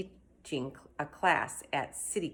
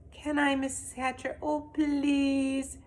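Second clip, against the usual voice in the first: put-on high, pleading girl's voice; begging; 9 semitones higher than usual. Girl begging the mother to let her babysit, high and pleading. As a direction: plead sweetly for the job.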